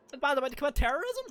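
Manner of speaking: very high, squeaky joke voice; squeaky mock voice. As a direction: squeak it out in disbelief.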